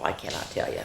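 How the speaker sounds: unvoiced conspiratorial whisper; whispered aside. Reader confides to kids about Froggy, whispered. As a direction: whisper it like a secret.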